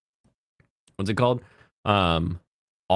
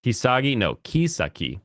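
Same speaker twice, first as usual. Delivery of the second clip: ordinary delivery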